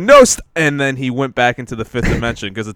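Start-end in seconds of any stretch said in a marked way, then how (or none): 0.0-0.4 sudden high, loud mock yelp; mock outcry in a retold tale; imp's exclamation. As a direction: blurt it out high and loud, cut short. Voicing the imp's backwards exclamation.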